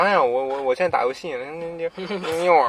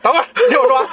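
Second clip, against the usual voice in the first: higher and louder, cutting back in; insisting over an interruption; 11 semitones higher, 9 dB louder, much faster than usual. Real, not acted. Pushing past the friend's interruption, loud and high. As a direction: talk over him and hold the floor.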